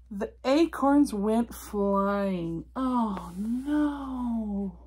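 slow, drawn-out storybook disaster; dramatic dismay. A narrator's reading. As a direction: stretch the words out in hammed-up alarm. Narrating the acorn disaster with dismay.

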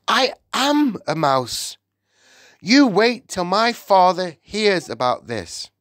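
high, loud-edged outrage; outraged cry. A narrator's reading. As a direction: cry out in horrified indignation. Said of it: "he cried".

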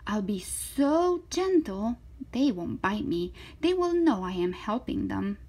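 lively, swooping story read; reading to children. Acted. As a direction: voice an eager, confident little girl. Reads to children, voicing Zoe.

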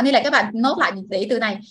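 usual speaking voice

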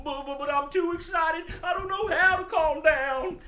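high, loud, frantic puppet voice; overexcited dog. Pretend. Puppet dog cannot settle down, high loud excitement. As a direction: wail it out, too worked up to settle. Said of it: "He's so excited".

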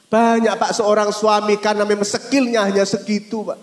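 loud, high, driving; fervent preaching. Real, not acted. Preacher defending poor husbands, loud and high. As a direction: preach it with full force.

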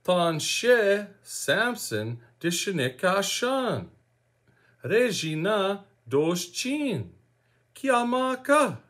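whole Michif introduction slowly, Regina as ray-zhee-nah; slow language demo. Shown as a demonstration. Teacher putting all those together for learners, slow careful demonstration. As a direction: model each phrase slowly and carefully, Kiamaka as kee-ah-mah-KA. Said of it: "it would be like this".

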